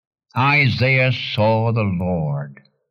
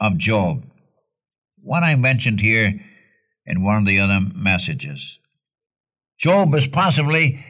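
ordinary talk, nothing marked

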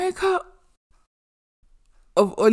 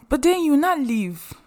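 ordinary delivery

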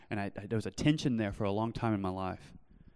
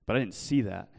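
ordinary talk

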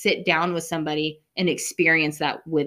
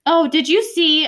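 sudden excitement; 9 semitones higher, 5 dB louder, slower than usual. Real, not acted.

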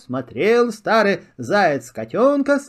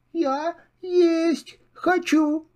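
small kitten voice; 10 semitones higher, much slower than usual. Acted. Kitten answers squirrel.

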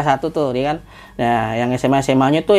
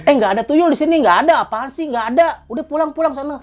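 higher, mildly shooing lady; dismissive lady; 9 semitones higher than usual. Retold or imitated. Lady waving off the kids at her door, lightly shooing. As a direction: brush the kids off, send them home.

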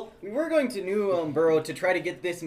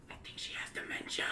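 hushed aside whisper; 12 dB quieter than usual. Acted. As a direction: whisper it behind her back. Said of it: "Matt whispers to".

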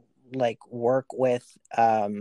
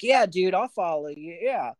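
dopey follower voice; 5 semitones higher, 3 dB louder than usual. Retold or imitated. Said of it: "Stu was just kind of like".

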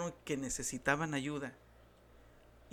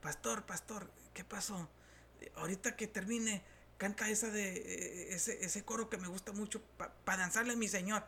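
higher, excited voice of a man pulling at the pastor; eager churchgoer interrupting; 5 semitones higher, 6 dB quieter than usual. Retold or imitated. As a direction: play the keen congregant tugging his sleeve mid-sermon. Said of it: "me paró así".

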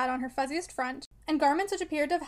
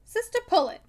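stern, loud rebuke; severe; 7 semitones higher, 7 dB louder than usual. A narrator's reading. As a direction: call her sister sharply to order. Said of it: "said Missus Glegg severely".

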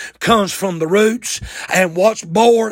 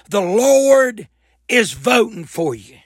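ordinary delivery

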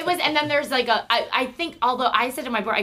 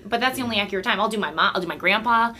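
ordinary talk, nothing marked

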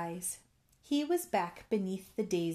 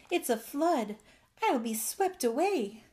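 higher-pitched mild alarm; crying out; 7 semitones higher, 3 dB louder than usual. A narrator's reading. Bullfrog fearing he'll be swept away, crying out. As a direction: cry out in storybook dismay. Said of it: "he cried".

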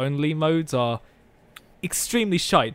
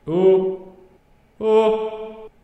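voice effect; 7 semitones higher, much slower than usual. Acted. Playing with voice effects.

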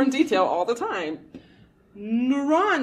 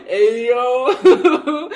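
loud, laughing startled call; laughing nervous yo; 5 semitones higher, 12 dB louder than usual. Retold or imitated. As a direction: greet the dog, half scared. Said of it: "I'm like".

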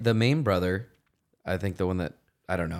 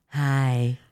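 lightly imitating a high feminine greeting; mock cashier's forced girly hello; put-on feminine voice; 5 semitones higher, 4 dB louder than usual. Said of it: "trying to be a chick voice".

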